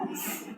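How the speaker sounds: "S" as a bare, soft hissed consonant; initial sound alone. Shown as a demonstration. Teacher isolating a syllable's initial sound, "S" as a soft hiss. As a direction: sound out "S" as just sss, no vowel. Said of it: "Here the initial sound is S".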